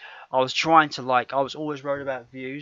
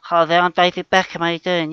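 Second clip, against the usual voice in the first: higher mock Beckham voice; David Beckham impression; 4 semitones higher, 6 dB louder than usual. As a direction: put on a jokey high Beckham voice. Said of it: "David Beckham sound like this".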